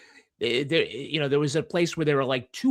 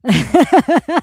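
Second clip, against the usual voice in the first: high, loud Boston Hot; Boston accent; 11 semitones higher, 12 dB louder than usual. Shown as a demonstration. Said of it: "Doc Hot".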